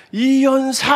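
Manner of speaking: imitated contest orator, high and drawn out; orator's shout. Preacher mimicking his champion classmate, orator's cry. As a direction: call it out like a schoolboy orator. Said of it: "하면서 막 외치는데".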